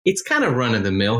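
usual speaking voice

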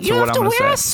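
mock the other side's whiny argument like a cartoon gingerbread man; mocking high gingerbread man voice. Arguing with friends about summer heat, mocking their complaint in a high cartoon voice. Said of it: "Did [name]'s voice remind any of y'all of the gingerbread man".

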